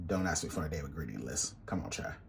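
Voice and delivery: low, hushed mock telling-off; mock scolding. Cook to viewers asking for an ingredient list, quiet mock scolding. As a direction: scold them playfully under your breath, low and soft.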